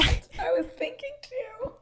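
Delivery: unvoiced whisper. Real, not acted. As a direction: whisper it as an aside. Whispered aside during banter.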